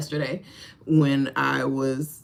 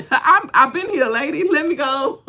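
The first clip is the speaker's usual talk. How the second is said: higher and louder, laughing; laughing exasperation; 9 semitones higher, 7 dB louder than usual. Acted. Man holding the door, exasperated, laughing.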